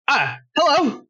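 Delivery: high, surprised greeting; shopkeeper woman voice. Acted. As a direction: greet customers as a gruff shopkeeper. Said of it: "That's not a woman's voice".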